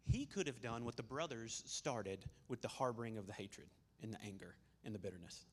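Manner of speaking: usual speaking voice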